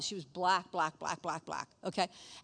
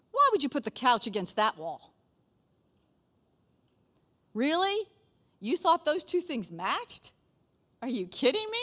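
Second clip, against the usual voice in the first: incredulous, scoffing at the couch; hammed-up disbelief at husband's choice; mock scornful spouse; 4 semitones higher than usual. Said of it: "I do this to my husband".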